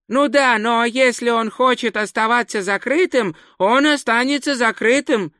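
high-pitched, flustered little tourist; sheepish high character voice; embarrassed explaining. A narrator's reading. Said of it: "смущённо объяснил турист".

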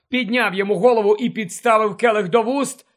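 ordinary delivery

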